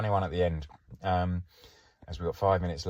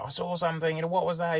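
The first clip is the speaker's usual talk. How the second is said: put-on a higher, wide-eyed child's question; excited kid; 12 semitones higher, faster than usual. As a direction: ask it like a kid who thinks he saw a shark. Kids spotting a fake fin.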